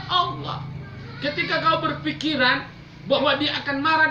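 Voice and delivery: loud, high impassioned preaching; fiery preaching. Acted. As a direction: drive it home to the congregation. Preaching at full force. (khotbah berapi-api, keras dan tinggi)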